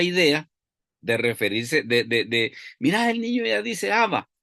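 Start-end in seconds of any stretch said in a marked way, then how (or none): 2.8-4.3 high, thrilled parent's cry; delighted parent. Retold or imitated. Parent announcing the baby's first word, high and thrilled. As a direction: burst out proud that the baby talks.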